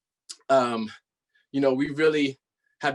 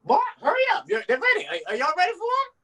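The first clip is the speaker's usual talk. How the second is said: much higher, louder granny calling; shrieking grandma; 13 semitones higher, 4 dB louder, faster than usual. Acted. Grandma yelling for the boy to come in, much higher. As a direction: holler for the boy like an old grandma. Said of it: "this is Paperboy's grandmother".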